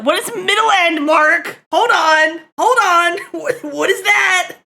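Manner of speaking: high, loud disbelief; incredulous outburst. Real, not acted. Streamer challenging Mark on middle end, loud incredulous outburst. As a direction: demand an explanation, loudly baffled.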